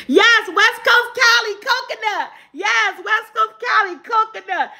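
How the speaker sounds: high-pitched, jubilant confirmation; excited cheer. Real, not acted. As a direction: announce the winner with delight. Host cheers a correct guess.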